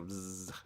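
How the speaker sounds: imitated bee buzzing; a quick buzzing noise. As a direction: buzz like a bee, low and soft. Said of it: "they go".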